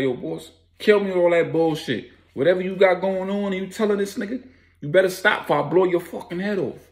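high, heated warning; acted threat. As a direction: threaten him with pitch pushed right up. Acted threat during confrontation.

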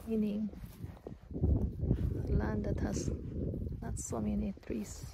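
normal talking voice

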